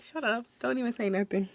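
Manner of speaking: retold hushed order to keep quiet; acted hushing. Worker hushing cackling coworker near customer, acted hushing.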